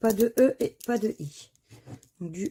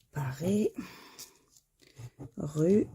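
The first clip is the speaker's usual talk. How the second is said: whisper; 2 semitones lower, 3 dB quieter, slower than usual. Real, not acted. Checking words on the ticket.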